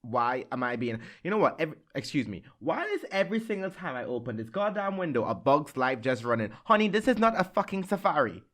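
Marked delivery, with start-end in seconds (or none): 2.6-8.4 high-pitched, comic indignation; exasperated rant. Real, not acted. Podcast host fed up with bugs coming in, exasperated rant. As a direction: rant in playful exasperation, rising to the end. Ranting at bee in the room.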